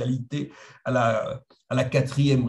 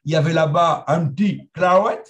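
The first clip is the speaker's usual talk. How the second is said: French with a put-on English accent, louder; English accent on French words, said a bit louder; Roger's English accent; 3 semitones higher, 6 dB louder than usual. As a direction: imitate a British historian speaking French. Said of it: "je suis capable d'imiter son accent".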